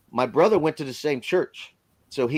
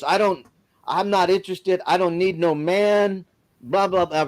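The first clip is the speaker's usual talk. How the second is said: higher, mock-mean brush-off; really mean; 5 semitones higher than usual. Woman on the phone turning him down, imitated as mean and higher. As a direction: imitate her snapping that she needs no man. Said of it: "really mean".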